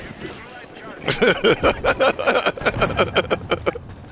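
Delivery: breathy high evil cackle; villain laugh. Acted. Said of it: "That was a good villain voice".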